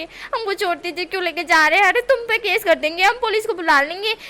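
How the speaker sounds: high, loud yelled threats; yelling. Acted. Wing yelling at bodyguards, loud hammed-up threats. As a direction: shout threats of police at them. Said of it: "चिल्ला चिल्ला के".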